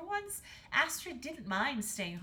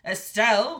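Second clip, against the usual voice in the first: loud call at usual pitch; calling king; 16 dB louder than usual. A narrator's reading. King calling the queen, loudly. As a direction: call out to your wife. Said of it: "called the king".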